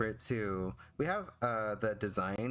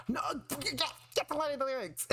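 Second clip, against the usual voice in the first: squeaky high scold to herself; high mock scolding voice. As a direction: nag like a shrill cartoon voice.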